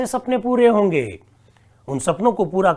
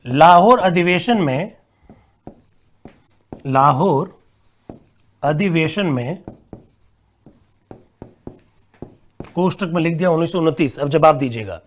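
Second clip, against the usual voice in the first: dictating word by word with long pauses; 2 semitones lower than usual. Acted. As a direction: read the question out for students to write. Dictates a question slowly.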